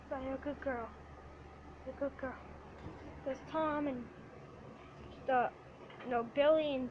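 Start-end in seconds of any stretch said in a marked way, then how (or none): 0.1-2.3 quiet, tender talk to a pet; soft pet talk. Real, not acted. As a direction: coo softly to the pet.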